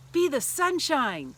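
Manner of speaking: slow, high, very loud yell. Retold or imitated. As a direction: bellow it so the whole team hears. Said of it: "he pointed to William and yelled".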